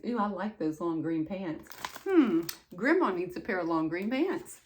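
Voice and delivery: usual speaking voice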